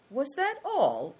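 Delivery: high, drawn-out disappointed question; slow, mildly disappointed query. A narrator's reading. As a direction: let down, voice lifted high.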